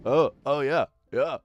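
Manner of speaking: a playful impression of a clueless viewer nodding along; mocking the viewer. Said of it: "and you're just like".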